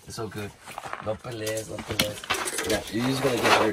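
soft, low hush to a fish; gently calm a struggling fish; soothing the fish. Real, not acted.

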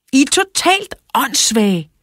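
high, loud shouted insult; shouting. A narrator's reading. Narrator fed up with the boys, shouting. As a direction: yell it in exasperation, pitch right up. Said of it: "råbte jeg".

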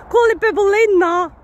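loud, high Nottingham accent. Shown as a demonstration. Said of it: "That's my Nottingham accent".

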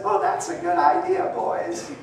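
mock head-patting approval, lilting up at the end; patronizing. Outsiders indulging the men's idea with a pat on the head, patronizing. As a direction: humour them like little children. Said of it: "patted them on the head".

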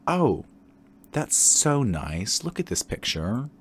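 slow, drawn-out excited oh. Real, not acted. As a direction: gush over the photo. Reacting to a profile pic.

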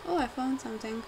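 ordinary delivery

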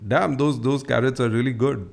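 ordinary talk, nothing marked

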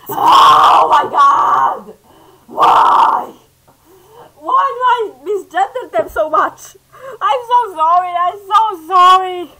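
loud, high, anguished apology; crying out in genuine upset; distressed shouting. Real, not acted. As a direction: wail the sorry in real distress. Distressed over misgendering.

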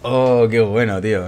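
loud, impressed exclamation; delighted cheer. Real, not acted. Gamer cheering a good play, loud and delighted. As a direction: burst out loudly at a great play.

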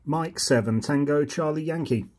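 ordinary talk

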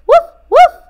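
very high, loud puppy bark; a happy dog yapping. A narrator's reading. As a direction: Zip barking with joy. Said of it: "barked Zip".

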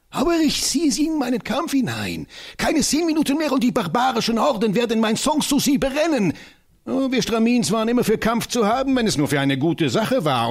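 high, fiery voice of the tiny professor; rally for battle with comic zeal; an excitable old scholar, pitched high; eccentric little professor. Acted.

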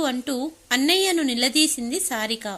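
ordinary delivery

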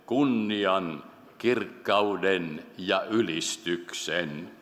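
slow, measured recital of praise; solemn scripture reading. Acted. Preacher reads the praise of the Lamb to the congregation, solemn and slow. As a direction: read each attribute slowly, leaving space after it.

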